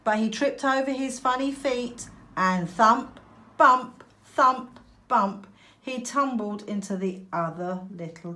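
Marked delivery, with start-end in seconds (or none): none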